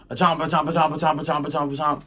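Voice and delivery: rapid rhythmic chomping imitation; Chomper. Storyteller compares it to Mario's creature, imitated chomping. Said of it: "or Chomper from Mario".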